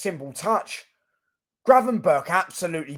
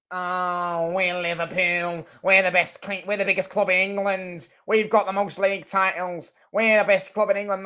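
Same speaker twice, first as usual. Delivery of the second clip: higher-pitched mock Scouse Liverpool fan; bad Scouse accent; 3 semitones higher than usual. Liverpool fan boasting about the club, in a bad mock Scouse accent. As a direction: put on a hammy Scouse accent, vowels drawn out. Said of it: "with that fucking shit accent".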